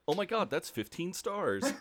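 high-pitched elated exclamation, laughing at the end; mock excitement. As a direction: gush it like a thrilled character. Quoting Ellen's excited funny line.

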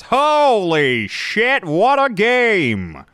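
high, loud, very slow announcer call; home run call. Shown as a demonstration. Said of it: "I just fell into my baseball announcer voice".

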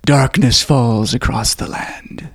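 slow ominous horror narrator; slow dramatic voice-over line; Vincent Price. Retold or imitated. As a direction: intone it slowly like a spooky movie voice-over. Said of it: "got Vincent Price to narrate the scene".

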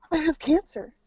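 high-pitched mock panic; retold dread. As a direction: imitate a frightened realisation after a gasp. Gasps, reacting to alarming emails.

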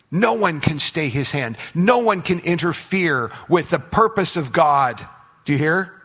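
emphatic sermon voice; forceful preaching. Acted. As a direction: drive the point home to the congregation. Pressing point from the pulpit.

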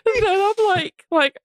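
high, breathless laughing fit; laughing hard. Real, not acted. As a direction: crack up, can barely speak.

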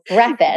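"Breakfast" said the doctor's wrong way; imitating mispronunciation. Teasing the doctor about her pronunciation, mimicked mispronunciation of "Breakfast". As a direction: mimic the co-host's botched "Breakfast". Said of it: "say it in such a fucked up way".

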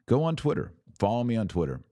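usual speaking voice

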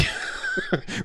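sudden high squeal of laughter; high laughing shriek. Real, not acted. Friend cracks up at her own Rudy joke, high squealing laugh.

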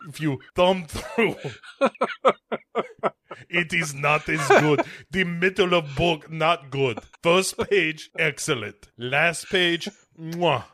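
put-on high, comic Eastern European-style accent; broken-English foreigner, hammed up and breaking into laughs; mock foreign accent. Book owner grading the pages, mock foreign accent.

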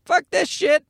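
put-on a cranky toddler brushing off toys; baby son's voice. Dad voicing baby son rejecting a toy, high mock baby grumble. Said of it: "He's just like".

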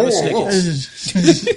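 slow, drawn-out commercial line; ad announcer voice. Acted. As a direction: deliver it like a TV ad. Quoting a candy ad.

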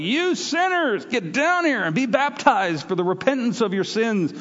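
high, slow hellfire call to sinners; fiery preacher. Retold or imitated. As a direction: boom it out like a river prophet. John the Baptist preaching in river.

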